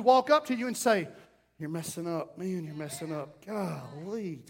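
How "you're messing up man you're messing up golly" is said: hushed rebuke. Acted. Pastor confronting a member, quoted.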